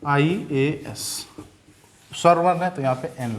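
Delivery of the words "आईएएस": आईएएस drawn out, starting on the vowel sound ai; slow pronunciation demo. Shown as a demonstration. Teacher sounding out आईएएस for students, slowly. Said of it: "उच्चारण भी देख लीजिए".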